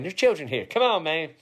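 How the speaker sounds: ordinary delivery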